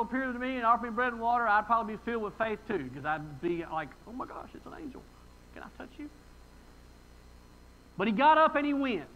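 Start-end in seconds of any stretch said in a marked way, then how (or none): 4.1-6.1 high, very soft awestruck voice; hushed awe. Pretend. Preacher imagining meeting an angel, hushed and awed. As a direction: play starstruck wonder, softly.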